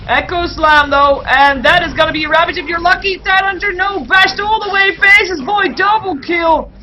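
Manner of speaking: high, loud esports hype; full-force excited play-by-play; shouted climax call. Real, not acted. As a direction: build to a shout as the kills land. Climax of team fight commentary.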